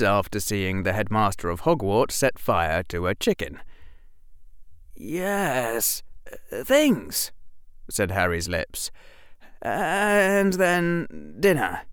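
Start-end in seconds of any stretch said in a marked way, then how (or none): 4.9-7.3 put-on high, stammering young Harry; a boy caught off guard, fumbling; nervous young boy voice; flustered boy. A narrator's reading. 9.6-11.8 put-on high, stammering young Harry; a boy caught off guard, fumbling; nervous young boy voice; flustered boy. A narrator's reading.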